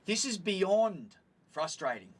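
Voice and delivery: BEYOND drawn out slowly and stressed; exasperated emphasis. Retold or imitated. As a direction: lean hard and slow on "beyond". Retold angry retort to attendant.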